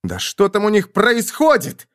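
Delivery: high-pitched exasperated demand; exploding in frustration. A narrator's reading. Younger brother explodes at not knowing what happens, high exasperation. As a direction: burst out with the question. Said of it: "взорвался младший из толком".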